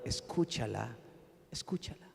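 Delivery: soft inner voice. Retold or imitated. Pastor recalls God's inner voice, softly hushed command. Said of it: "recordé la palabra".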